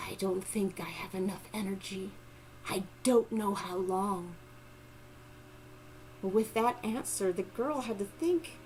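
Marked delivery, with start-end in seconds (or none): 0.1-4.4 barely whispered, feeble; weak whisper. Acted. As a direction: breathe it out, too weak to voice. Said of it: "in a very weak voice".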